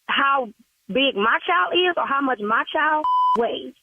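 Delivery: ordinary delivery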